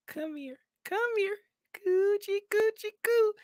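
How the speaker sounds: very high cooing coochie-coo. Real, not acted. Baby talk to a child.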